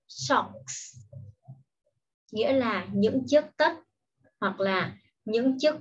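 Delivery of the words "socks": Socks whispered again, hissing s; whispered repeat. Shown as a demonstration. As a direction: breathe out Socks softly. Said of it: "Đó chính là". (thì thầm lặp lại Socks)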